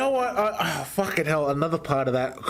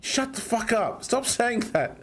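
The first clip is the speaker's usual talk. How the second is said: retold angry retort; 9 semitones higher than usual. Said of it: "in anger".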